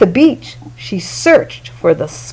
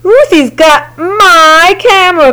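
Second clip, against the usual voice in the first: crying out; 6 semitones higher than usual. A narrator's reading. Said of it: "cried Martin".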